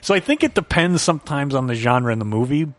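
usual speaking voice

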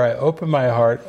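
normal talking voice

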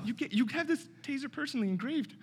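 hammed-up crying to a partner; mock crying. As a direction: fake tears to win him over. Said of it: "He's, like, crying".

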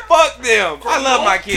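imitating a shouted, tearful cry of devotion; mock shout. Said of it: "she said".

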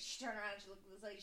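ordinary talk

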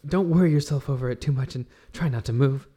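soft, gentle comfort; tender reassurance. Acted. Alex comforts the wounded Pavel, soft and tender. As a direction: soothe the wounded friend.

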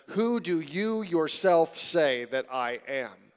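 slow, weighing each word of "Who do you yourself say that I am?"; emphatic slow question. Shown as a demonstration. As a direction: lean on "yourself" as you ask it slowly. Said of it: "It literally says".

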